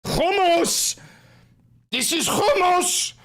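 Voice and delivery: high-pitched outburst with a throaty H; high, exclaimed character voice; put-on excited cry. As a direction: cry it out very high, indignantly proud. Put-on shouted hummus bit.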